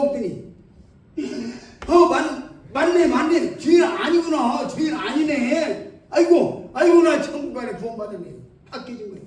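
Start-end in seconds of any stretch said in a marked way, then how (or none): 1.9-8.3 higher, laughing excited shouts; joyful realization. Retold or imitated. Elder realizing she is saved, laughing and shouting higher. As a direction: burst out laughing with delight.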